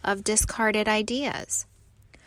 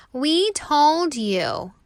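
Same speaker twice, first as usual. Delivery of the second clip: slow, higher, louder told-you-so; taunting; 6 semitones higher, 6 dB louder, slower than usual. Acted. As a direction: drag it out to gloat. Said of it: "taunt the other leaves".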